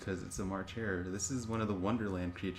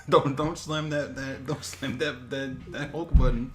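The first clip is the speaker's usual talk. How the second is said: slow, giggling don't slam that; laughing warning; 4 semitones higher, 8 dB louder, slower than usual. Real, not acted. Warning the co-host off the scale, laughing hard. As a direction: barely hold it together.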